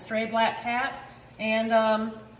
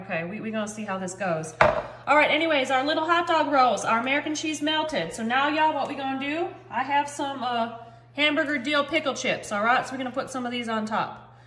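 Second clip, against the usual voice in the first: ordinary talk, nothing marked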